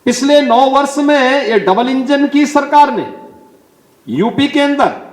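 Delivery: high, ringing rally declaration; high-pitched podium proclamation; political oratory. Real, not acted. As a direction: proclaim it to the crowd, pitched well up.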